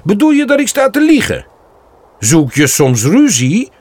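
put-on a young buck picking a fight; angry. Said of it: "zegt Ronno boos".

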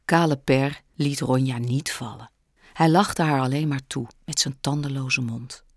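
ordinary delivery

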